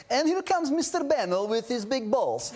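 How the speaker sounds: high, hammed-up Russian voice; put-on Russian accent, pitched well up; comic Russian accent. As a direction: imitate a jokey Russian announcing an arrival. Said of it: "why did it have to be Russian".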